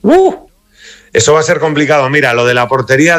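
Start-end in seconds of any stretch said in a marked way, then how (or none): 0.0-0.5 high-pitched "ooh" at a tough question; daunted "uh". Real, not acted. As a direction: let out a high, drawn "uh". Reacts to tough lineup question.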